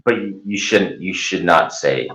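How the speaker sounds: ordinary talk, nothing marked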